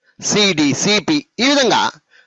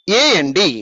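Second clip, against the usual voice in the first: AND run together as one unit; joined letters; much slower than usual. Shown as a demonstration. Teacher modelling for pupils how to read, AND joined without breaks. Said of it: "అన్ని కలిపేసి చెప్పేస్తూ ఉండాలి".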